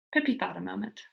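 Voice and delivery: usual speaking voice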